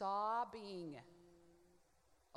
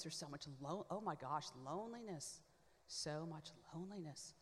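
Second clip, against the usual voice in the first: softer, hushed, grief-struck; overwhelmed grief; 3 semitones lower, 3 dB quieter than usual. Retold or imitated. Reliving the prayer where she wept over loneliness, hushed and overwhelmed. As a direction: let the despair sink the voice. Retelling weeping over despair.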